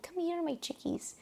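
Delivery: lightly voiced guide, high and soft; tour guide calling. Tour guide calling his group chickies, a light hint of a hushed showman. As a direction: coo it like a showman gathering his flock. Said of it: "He was like".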